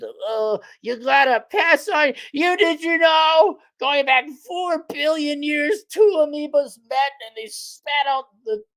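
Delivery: hammed-up lecture on ancestry; mock lineage preacher. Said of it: "when you say".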